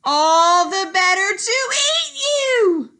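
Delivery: loud, high, slow snarling wolf shout; wolf snapping. Acted. Wolf revealing himself to the girl, loud snapping shout. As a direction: lunge with a fierce snap. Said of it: "he snapped".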